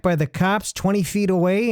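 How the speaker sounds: usual speaking voice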